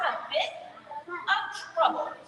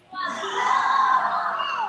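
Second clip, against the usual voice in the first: roar; 4 semitones higher, 6 dB louder than usual. Shown as a demonstration. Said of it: "And what do they say?".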